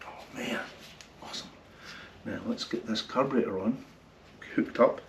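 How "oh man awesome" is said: ordinary delivery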